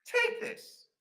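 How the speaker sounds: very high-pitched fairy order; fairy man. Acted. Fairy man hands Flanagan a gift, in a high voice. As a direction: thrust it at him with a high squeal.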